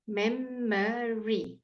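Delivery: memory very slowly, mem-muh-ree; teacher's slow, three-syllable memory; drawn-out syllable demo. Shown as a demonstration. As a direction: stretch memory so the middle uh is heard. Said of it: "Can you hear it?".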